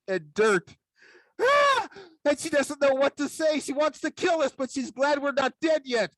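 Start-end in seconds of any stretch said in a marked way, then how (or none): 1.4-1.9 high panicked gasp-cry; short, high cry of horror; hammed-up speechless shriek; mock shriek. Mother shocked, speechless at mess. 2.3-6.1 high, strained panicky narration; hammed-up distraught mother; mock frantic.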